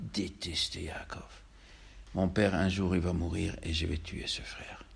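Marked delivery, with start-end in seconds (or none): 0.1-1.3 whisper. A narrator's reading. Narrator tells of hatred.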